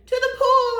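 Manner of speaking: high, loud excited shout; boy shouting to the fire crew; boy yelling. Acted. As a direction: yell it back at the firemen. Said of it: "I yelled".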